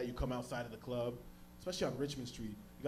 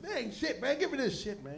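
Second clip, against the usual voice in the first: mock tough guy; 10 semitones higher than usual. High voice after first puff.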